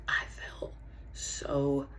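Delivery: slow, whispered admission; halting, painful near-whisper; hushed confession. Acted. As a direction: barely get the words out.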